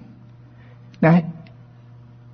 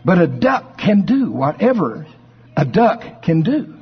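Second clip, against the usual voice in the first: usual speaking voice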